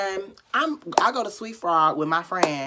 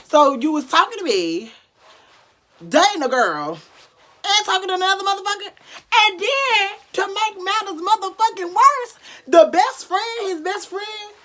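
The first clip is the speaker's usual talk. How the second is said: very high, loud outraged rant; real indignant rant; furious rant; 11 semitones higher, 7 dB louder than usual. Real, not acted. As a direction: build the outrage higher. Ranting about the betrayal.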